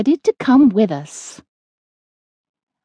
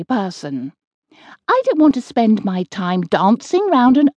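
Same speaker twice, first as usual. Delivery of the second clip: Mabel the gentle witch; 7 semitones higher than usual. Acted. Mabel answers Maud.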